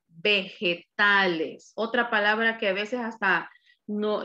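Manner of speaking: normal talking voice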